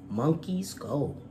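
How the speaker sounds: ordinary delivery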